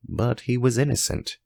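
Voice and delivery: plain reading voice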